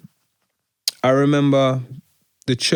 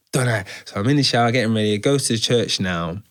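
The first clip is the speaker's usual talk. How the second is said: ordinary talk, nothing marked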